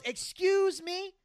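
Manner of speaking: slow, drawn-out offended question; mother stops Archie with a slow offended retort; indignant mock retort. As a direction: throw it back at him, slowly and affronted. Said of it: "and she fucking goes".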